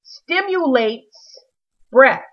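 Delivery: slow, drawn-out reading of the label; reading the label slowly. Retold or imitated. Reading the box label aloud, slow and puzzled. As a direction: spell out each word in disbelief. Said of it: "the little tag said on the box".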